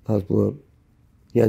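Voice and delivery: ordinary talk, nothing marked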